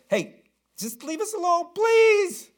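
high, squeaky begging demon voice; pleading demon. Acted. Frightened demon begging Jesus to leave, high and squeaky. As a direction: whine it like a scared little demon. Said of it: "And so he says".

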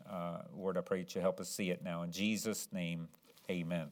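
soft, low-pitched prayer; hushed pulpit prayer. Real, not acted. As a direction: pray quietly and humbly, voice sinking toward the amen. Leads the congregation in prayer.

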